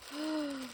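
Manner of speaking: short, high gasp of delight; real delighted intake of breath; surprised gasp. Real, not acted. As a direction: gasp at a new discovery. Discovers another item in the gift.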